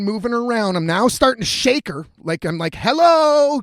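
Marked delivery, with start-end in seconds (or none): 2.7-3.6 loud, high "hello" to rouse a sleeper; calling to wake. Retold or imitated. Man shaking a snoring guest awake, loud exasperated call. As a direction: holler it at someone who won't wake.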